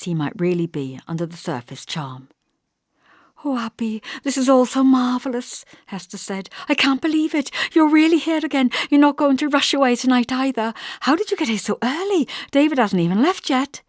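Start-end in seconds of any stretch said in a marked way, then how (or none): 3.4-5.6 put-on high, excited young woman; bubbling over with disbelief and joy; eager Hester. A narrator's reading. 6.7-13.8 put-on high, excited young woman; bubbling over with disbelief and joy; eager Hester. A narrator's reading.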